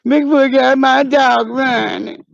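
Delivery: slow, drawn-out hammed-up lament; distressed wail. Owner finding huge footprint, slow mock-distressed wail. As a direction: wail it slowly, dragging every word.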